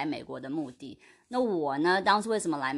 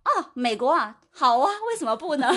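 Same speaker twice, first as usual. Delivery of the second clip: put-on higher, louder, laughing yes from a teen; 笑着兴奋地答应; eager teenager; 10 semitones higher, 6 dB louder than usual. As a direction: toss it off like a thrilled kid who thinks it's a great idea. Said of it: "那我一个teenager，当然说".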